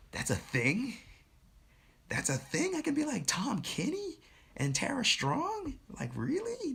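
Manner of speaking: high-pitched, incredulous questioning; amazed disbelief. Retold or imitated. Student learning voice acting is a job, high-pitched disbelief. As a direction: re-enact astonishment, pitch climbing way up.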